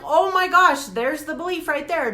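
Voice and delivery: sudden realization. Retold or imitated. Recalling the moment she found the belief: loud surprise. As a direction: retell the moment it clicked, loud.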